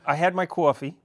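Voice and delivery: mock New York accent on "coffee". Guest teasing host about his morning coffee, New York accent. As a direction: playfully imitate the host's New York accent. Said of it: "imitating me now".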